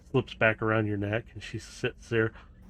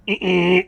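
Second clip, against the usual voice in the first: sharp high frustrated "eh, eh"; annoyed little grunts; 7 semitones higher, 11 dB louder than usual. Acted. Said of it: "and you hear".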